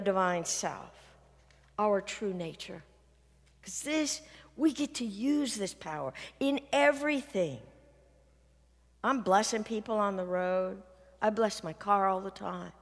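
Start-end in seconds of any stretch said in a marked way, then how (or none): none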